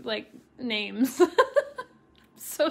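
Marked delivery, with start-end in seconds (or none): 1.3-1.8 laugh. Real, not acted. Embarrassed about geography.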